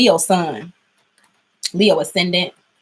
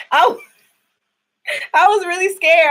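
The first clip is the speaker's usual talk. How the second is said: shaken laugh; 10 semitones higher, 4 dB louder, much slower than usual. Real, not acted. Still shaken after the scare.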